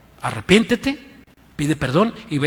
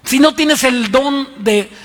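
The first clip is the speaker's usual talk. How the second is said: slow emphatic warning; 8 semitones higher, 6 dB louder, slower than usual. Real, not acted. Preacher stressing slowly.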